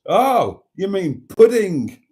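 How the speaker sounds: loud, slow, high mock shout of a confused kid; imitating a kid shouting to his posh mum; retold shout. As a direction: blurt it out across the restaurant. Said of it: "you shouted at the top of your voice".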